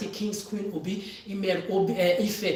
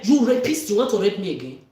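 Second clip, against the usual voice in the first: furious shout; 9 dB louder than usual. Real, not acted. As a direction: hurl it at them at full volume. Confronting her abusers, shouting.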